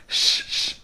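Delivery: whispered shushing শ; breathy শ hiss. Shown as a demonstration. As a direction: hiss শ like wind. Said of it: "শন শন আওয়াজে".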